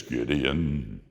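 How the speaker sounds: God answers the dancing Gideon, low and gentle; God's voice. Acted. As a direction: speak like a patient, loving God. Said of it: "And God says".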